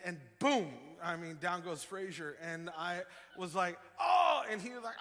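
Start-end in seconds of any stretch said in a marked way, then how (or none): 4.0-4.4 high, loud "oh" of mock alarm; retold alarmed cry. Dad retells seeing his son fall, alarmed cry.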